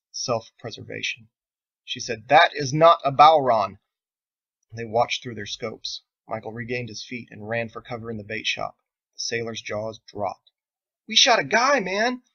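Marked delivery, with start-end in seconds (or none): 2.3-3.8 firm declaration. Acted. Spotter realizes target is different. 11.1-12.2 alarmed. Acted. Sailors panic after shooting man.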